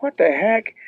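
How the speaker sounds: high-pitched, amazed question; astonished reaction. Retold or imitated. Dad hears son's TV news, high-pitched retold astonishment. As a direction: replay a startled dad's disbelief.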